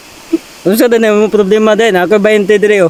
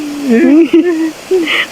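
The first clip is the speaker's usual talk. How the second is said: real laugh; 8 semitones higher than usual. Real, not acted. Laughs at friend's story.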